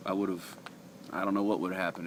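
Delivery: usual speaking voice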